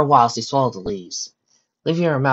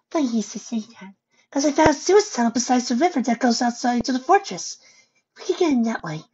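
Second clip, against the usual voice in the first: Fluttershy; 13 semitones higher than usual. Acted. Fluttershy telling friends about the sewage tunnel, high voice. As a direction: explain the plan in a high, light voice. Fluttershy explains her plan.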